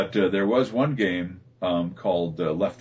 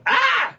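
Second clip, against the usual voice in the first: sudden high dying scream; game character's death cry, a high, sudden scream; 6 dB louder than usual. Shown as a demonstration. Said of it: "you're just going".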